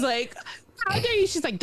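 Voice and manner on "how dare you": high, imitated outraged mom; mock mom scolding. Mom yelling at the returned child, high mock outrage. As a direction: mimic a mother telling off her kid. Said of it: "my mom is yelling at me".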